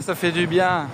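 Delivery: ordinary talk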